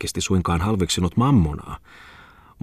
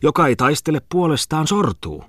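higher-pitched, declamatory character voice; character declaiming; 8 semitones higher than usual. Acted. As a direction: proclaim it, pitched up. Acted debate between characters.

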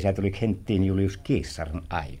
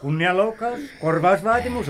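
higher, urgent legal threats; agitated threats; 9 semitones higher than usual. Acted. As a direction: rattle off the charges in alarm. Lawyer shrilly listing legal threats. (kiihtyneenä, korkealta)

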